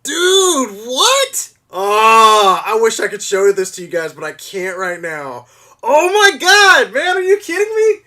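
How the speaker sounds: overwhelmed with joy, near-screaming; real excitement. Real, not acted. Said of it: "That was so exciting".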